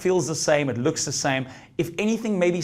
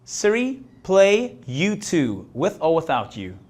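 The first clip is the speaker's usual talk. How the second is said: slow clear command; 5 semitones higher, slower than usual. Real, not acted. Commanding a voice assistant.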